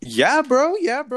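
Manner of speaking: high, loud hype yell; hype shout. Acted.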